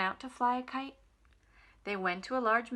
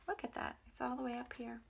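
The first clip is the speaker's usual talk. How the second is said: quiet aside; 9 dB quieter, faster than usual. Real, not acted. Reader showing picture to kids.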